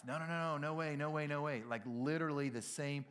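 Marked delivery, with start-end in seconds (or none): 0.1-1.6 rapid-fire, higher-pitched protest; insistent denial. Acted. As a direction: protest over and over without a gap. Acting Peter's protest at the prediction.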